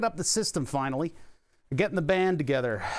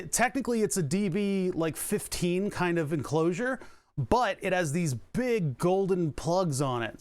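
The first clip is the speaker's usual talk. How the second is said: ordinary talk, nothing marked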